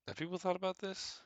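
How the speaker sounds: high, disbelieving question; squeaky, high incredulous question. Real, not acted. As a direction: ask it in disbelief.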